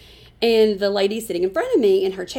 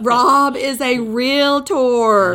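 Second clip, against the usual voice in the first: slow, loud, drawn-out declaration; mock announcement; 2 semitones higher, 7 dB louder, much slower than usual. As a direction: announce it like a billboard. Continued mock advertisement.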